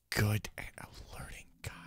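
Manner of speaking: sarcastic whisper; whispered self-mockery. Real, not acted. Sarcastic aside while sneaking.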